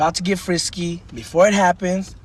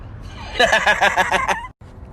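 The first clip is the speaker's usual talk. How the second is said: higher-pitched laughing fit; real laugh; 5 semitones higher than usual. Real, not acted. Comic laughs at his own joke, real laugh. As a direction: laugh out after a punchline.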